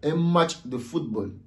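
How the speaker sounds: ordinary talk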